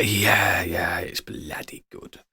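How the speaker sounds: slow, hushed whisper; mock game-character whisper. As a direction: whisper it slowly, drawing out the words. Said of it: "everybody talks like this".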